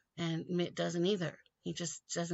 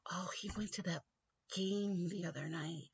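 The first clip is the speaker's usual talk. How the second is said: whispered aside; hushed realization; 5 dB quieter than usual. Real, not acted. Realizing where Romney got the idea, whispering. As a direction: drop to a whisper as it dawns. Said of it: "sudden realization, hushed".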